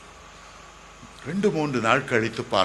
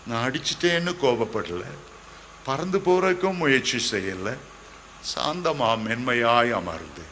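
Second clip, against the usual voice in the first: ordinary talk, nothing marked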